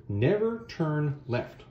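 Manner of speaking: drawn-out, very slow mock-solemn advice; a mock life lesson, dragged out; slow warning. Shown as a demonstration. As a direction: spell out the lesson word by word. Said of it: "take note of this one".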